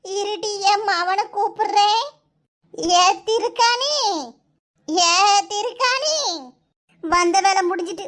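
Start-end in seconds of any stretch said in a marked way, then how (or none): 2.9-6.2 long drawn-out call with rising end. Acted. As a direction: stretch the name out as you summon him. Calling out for her son. (நீட்டி உரக்கக் கூப்பிடும் குரல்)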